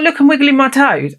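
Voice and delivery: high as a kite and delighted; giddy excitement. Retold or imitated. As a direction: show off your toes like a delighted child. High on drugs, showing off toes.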